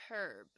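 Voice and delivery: herb with a clearly sounded H; 英式发音herb，H要发音; British pronunciation. Shown as a demonstration. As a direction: say herb with a full H at the start. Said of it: "H要好好的发音，herb就要念成".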